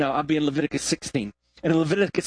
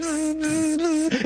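high buzzy zzz imitating a bee; bee buzzing; 10 semitones higher, much slower than usual. Preacher imitating the bee buzzing again. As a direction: buzz it round the boy's face.

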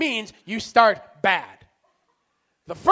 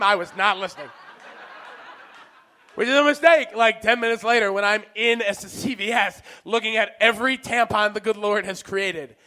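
ordinary talk, nothing marked

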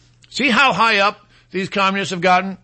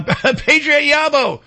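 laughing; 6 semitones higher, 3 dB louder, slower than usual. Real, not acted. Laughing while reading name.

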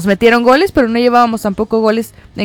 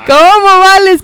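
mock crying; 8 semitones higher, 6 dB louder, slower than usual. Echoing a crying line.

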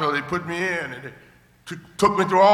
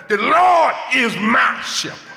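proclaiming shout; 5 semitones higher, slower than usual. Retold or imitated. Preacher retelling his mother's prayer, slow ringing shout. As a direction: call it out slowly like a mother's morning faith.

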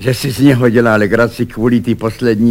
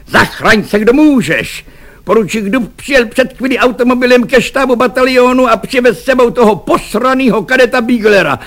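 high, loud urgent outburst; alarmed warning; 13 semitones higher, 4 dB louder than usual. A narrator's reading. Volunteer bursts into the kitchen to warn his friends, high urgent alarm.

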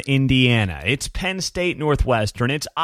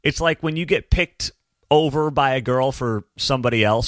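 normal talking voice

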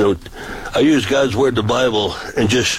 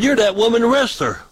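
high, gleeful calling out of the name; mock-excited high accusation; excited recognition; 11 semitones higher than usual. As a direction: crow it out like you caught her. Teasing recognition of wrestler.

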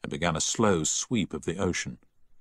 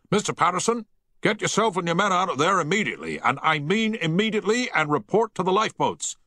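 bellowing; 12 semitones higher than usual. A narrator's reading. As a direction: bellow through the voice pipe. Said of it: "Havens bellowed down the voice pipe".

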